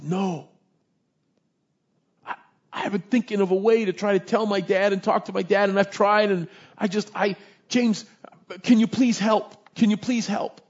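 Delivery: desperate son begging. Retold or imitated. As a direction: beg the pastor to help, close to breaking.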